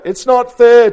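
high, loud complaint; storybook tortoise protest; aggrieved tortoise. A narrator's reading. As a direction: wail the injustice. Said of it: "Tortoise sniffed".

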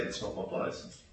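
1 quiet excuse. Retold or imitated.

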